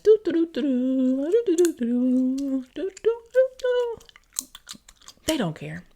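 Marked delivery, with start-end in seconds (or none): 0.0-4.0 loud, high, slow doo doo doo, rising higher at the end; playful mockery of kids' empty heads; empty-headed humming. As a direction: mimic a blank brain humming. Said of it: "it's just in there like".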